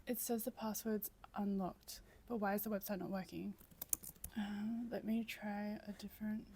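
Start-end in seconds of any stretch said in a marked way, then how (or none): none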